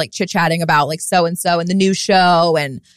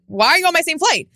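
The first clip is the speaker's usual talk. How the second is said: mock annoyed question; 8 semitones higher than usual. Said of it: "but also like".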